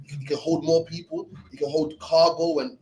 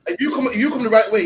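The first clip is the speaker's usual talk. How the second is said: ordinary talk, nothing marked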